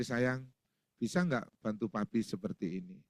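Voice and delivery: soft, low, affectionate asking; tender, low-key husbandly request; gentle request. Shown as a demonstration. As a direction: ask your wife sweetly and quietly. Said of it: "Bilang aja kepada istri".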